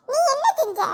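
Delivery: ordinary talk, nothing marked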